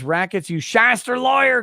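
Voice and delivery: high, loud name-calling; mock indignant shout. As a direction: holler the insult in mock outrage. Reads insult as an outcry.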